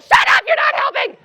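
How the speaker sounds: high-pitched yell in mock panic; exasperated shout. Panicked rider to the CEO beside her, shrieked retort. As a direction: scream it at someone making things worse.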